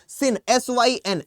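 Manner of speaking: sounding out S Y N slowly; slow spelled root. Shown as a demonstration. Teacher slowly spelling सिन for students. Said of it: "जैसे कि सिनोनिम".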